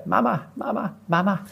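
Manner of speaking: put-on high child calls of mama; high, faint child calling mama; children calling out. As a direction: call out like a lost child in the dark.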